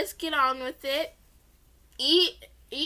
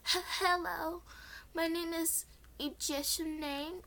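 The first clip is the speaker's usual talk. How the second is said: nervous; 6 semitones higher, 6 dB quieter than usual. Acted. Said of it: "tried to speak as I gulped".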